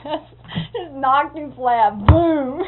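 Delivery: hammed-up loud impact; loud retelling. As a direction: land the boom hard, half laughing. Retold uncle knocked flat.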